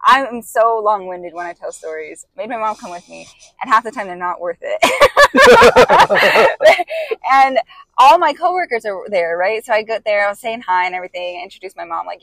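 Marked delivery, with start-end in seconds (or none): 4.8-7.2 high burst of laughter. Real, not acted. Laughing at her own long story.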